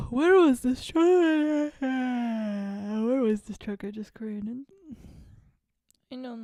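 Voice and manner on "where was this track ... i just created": surprised. Real, not acted. Lost track, gasping in surprise.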